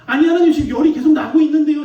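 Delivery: loud, high-pitched mock protest; arguing back. Pastor retelling how he argued with God about his fever, loud protest. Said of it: "제가 막 속으로 따졌어요".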